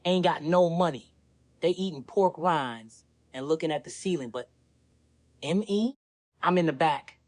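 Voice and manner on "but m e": usual speaking voice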